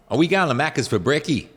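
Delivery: chummy put-on Australian twang; Australian slang accent. Imitating Australians asking about breakfast, exaggerated Australian accent. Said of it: "they talk almost in code".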